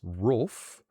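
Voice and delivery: "roof" with a short oo as in book. Shown as a demonstration. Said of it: "pronounce roof".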